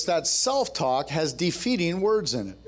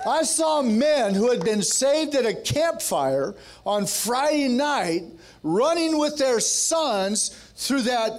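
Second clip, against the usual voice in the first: high, driving preacher cadence; rally the congregation with rising fervor; impassioned call, stretching the key phrases; rousing preaching; 6 semitones higher than usual. Real, not acted. Preacher rallies the men.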